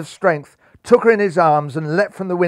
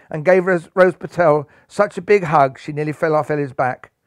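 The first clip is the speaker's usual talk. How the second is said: ordinary delivery, nothing marked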